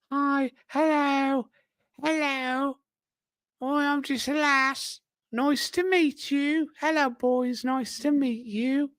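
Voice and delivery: put-on very high, drawn-out girly voice; put-on high voice of a comic-strip lass; falsetto lass. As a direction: greet the lads in a slow, squeaky falsetto.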